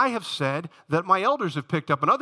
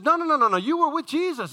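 high-pitched, insistent accusation; accusing servant girl; 10 semitones higher, 3 dB louder than usual. Acted. Servant girl accusing Peter in story.

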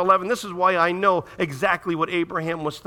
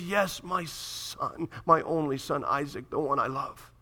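tender father; 3 semitones lower, 6 dB quieter, slower than usual. Acted. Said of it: "And he says".

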